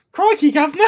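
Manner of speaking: very high, loud mock Cockney; hammed-up cartoon English accent through giggles; Oscar Isaac's bad English accent. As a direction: squeal it like Bert meets Mickey Mouse. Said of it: "like, Bert meets Mickey Mouse".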